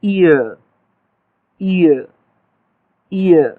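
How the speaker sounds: ईयर said slowly and clearly, a pause after each; ईयर spoken as a slow, careful demonstration; drawn-out demo word. Shown as a demonstration. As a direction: model ईयर carefully for students to copy. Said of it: "बोल के देखिएगा जरा".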